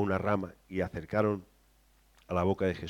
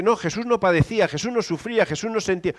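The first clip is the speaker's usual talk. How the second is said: mocking denial; 7 semitones higher than usual. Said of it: "Que es que Jesús dice".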